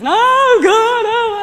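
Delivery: a high, loud anguished wail; reliving a tearful outburst; retold crying. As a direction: cry out in despair as the nose breaks again. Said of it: "and I'm crying".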